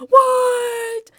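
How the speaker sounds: very high, loud drawn-out cry; shocked shriek. Real, not acted. Player learning the alien wants her, shocked shriek. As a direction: squeal it in disbelief.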